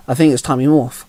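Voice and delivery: usual speaking voice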